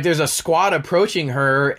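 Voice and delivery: ordinary talk, nothing marked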